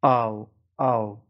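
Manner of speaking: او drawn out slowly from a into u; او said slowly and deliberately, a-u glide; slow ow diphthong. Shown as a demonstration. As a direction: stretch each او slowly, gliding a to u.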